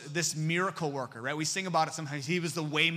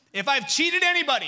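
bold declaration; 10 semitones higher, 9 dB louder than usual. Acted. Zacchaeus making his bold promise.

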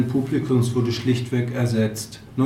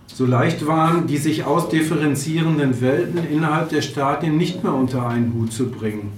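ordinary delivery

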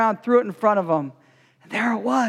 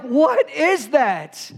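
amazed; much slower than usual. Retold or imitated. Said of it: "I was like".

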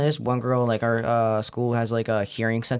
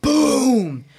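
high, booming burst of sound; loud imitated boom; 10 semitones higher, 5 dB louder than usual. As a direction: shout out an explosion sound. Imitates balloon exploding mid-story.

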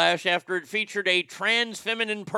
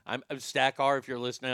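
ordinary talk

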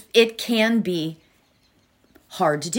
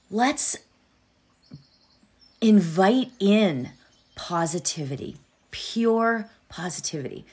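guided meditation. Acted.

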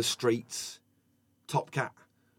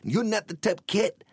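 higher, lightly voiced cartoon cat host; 6 semitones higher than usual. Top Cat hosting a street game show, higher and cartoonish. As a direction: announce it like a cheeky animated game show host. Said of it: "he'd be like".

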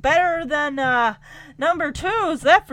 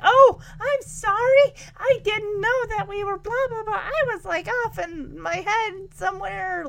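ditzy contestant; 7 semitones higher than usual. Retold or imitated. Dazed contestant apologizing to the host, high and whimpering. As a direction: make her a flustered, teary space cadet.

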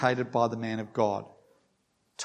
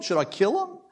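same question pitched much higher; king asking again, more eagerly; higher shouted repeat; 10 semitones higher, faster than usual. A narrator's reading. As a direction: push the repeat up into an excited shout. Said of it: "he shouted out".

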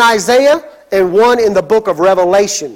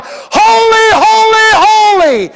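answering seraphim cry; 10 semitones higher, 3 dB louder than usual. Acted. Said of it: "would reply".

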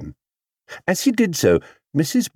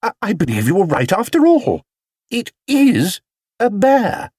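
high, surprised exclamation; exclaimed; 12 semitones higher than usual. A narrator's reading. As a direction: exclaim it high, stretching the end. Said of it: "she exclaimed".

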